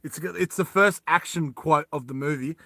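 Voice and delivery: usual speaking voice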